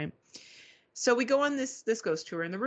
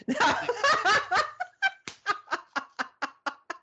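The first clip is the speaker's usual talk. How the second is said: high laughter; 14 semitones higher than usual. Real, not acted. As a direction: laugh hard and high at the joke. Reacting to friend's joke.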